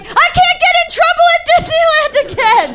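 very high, loud frantic shriek; panicked shout. Retold or imitated. Retold panic at more Disney trouble.

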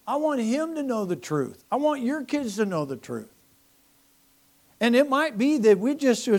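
normal talking voice

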